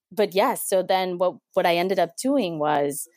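ordinary talk, nothing marked

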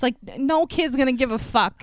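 ordinary talk, nothing marked

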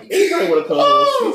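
loud, high and fast call-out; mock shout. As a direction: yell out for a new co-host.

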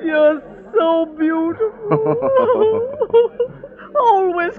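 tearful. Acted. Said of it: "stop that weeping".

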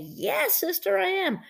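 playful high call-and-response; mock churchgoer reply. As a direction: answer like a devoted congregation member. Answering the preacher's question.

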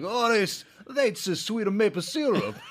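high, laughing caricature voice; mock Guido voice. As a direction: play a delighted Guido. Imitating Guido tapping trees.

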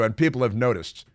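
usual speaking voice

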